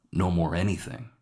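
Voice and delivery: ordinary delivery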